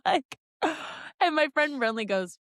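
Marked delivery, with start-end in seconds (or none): 0.6-1.2 sharp intake of breath; stunned little gasp; shocked gasp. Retold or imitated. Acted reaction to child's answer.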